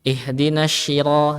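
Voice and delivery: "ihdinas shira" with sh for the heavy s; thin sh in "ihdinas shira"; shad turned shin. Shown as a demonstration. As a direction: hush the s into "shee" in "ihdinas shira". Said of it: "menjadi huruf shin".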